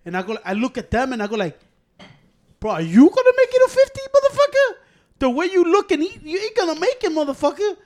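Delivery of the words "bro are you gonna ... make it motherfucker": high, loud incredulous squawk; mock outrage. Clapping back at people who nag about kids, high mock outrage. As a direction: throw it back at them loud and high-pitched.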